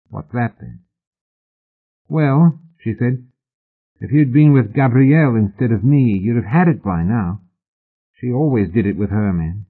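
a hint of a seductress; seductive woman's voice. A narrator's reading. Narrator voices Hetty.

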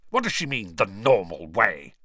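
irritated uncle, leaning hard on the last phrase; indignant, spitting question; spat out angrily. A narrator's reading. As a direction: spit the words out with contempt. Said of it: "he spat".

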